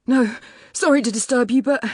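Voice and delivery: high, loud, breathless rush; out of breath. A narrator's reading. Young builder interrupting the homeowner after running over, out of breath. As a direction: blurt it out, winded from running. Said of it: "he sounds out of breath".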